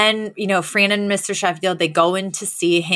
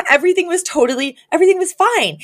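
raised high, rushed and theatrical; imitating a distraught socialite, pitched high; high dramatic Cece; 10 semitones higher, faster than usual. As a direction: play Cece near tears but hamming it up. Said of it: "And she's like".

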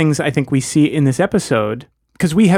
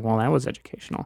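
dry sarcastic; 2 semitones lower, 9 dB quieter, faster than usual. Retold or imitated. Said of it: "rolls her eyes and says".

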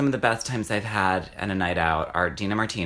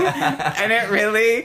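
laughing; 11 semitones higher, 6 dB louder, slower than usual. Real, not acted. Still laughing.

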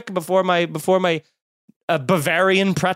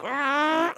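dog toy growl; 9 semitones higher, 5 dB quieter than usual. Retold or imitated. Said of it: "like a dog toy that I had".

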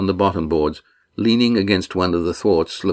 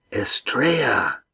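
slow, breathless whispered name; hushed realisation; 5 semitones higher, slower than usual. A narrator's reading. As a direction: whisper it as the penny drops.

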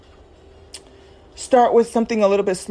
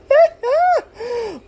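short high burst of laughter. Real, not acted. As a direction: laugh at your own stumble. Laughs at own slip.

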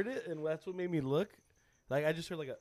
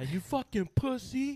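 high, drawn-out mock abuse; mock angry insult; 8 semitones higher, 4 dB louder, slower than usual. Coach imagines what he wanted to tell the kid, mock angry. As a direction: stretch the insult out, pitched high.

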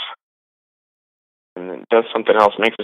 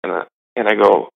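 ordinary talk, nothing marked

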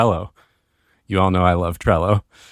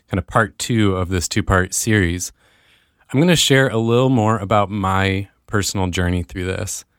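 normal talking voice

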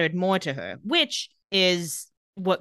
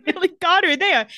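laughing hard at the line; 11 semitones higher, 6 dB louder than usual. Real, not acted. Cohost laughs at the burn, laughing loudly. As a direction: burst out laughing while speaking up.

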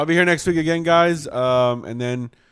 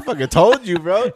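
high, loud I-told-you-so; mock yelling at a buddy; 8 semitones higher, 8 dB louder than usual. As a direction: shout it back at him with a laugh in it. Retelling yelling at friend.

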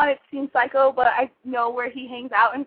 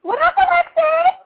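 shouted greeting; 8 dB louder than usual. Real, not acted.

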